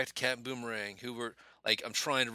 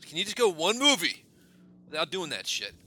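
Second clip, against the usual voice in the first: exasperated; 6 dB louder than usual. Retold or imitated. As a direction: start resigned, then burst out fed up. Acting out his exasperated reaction.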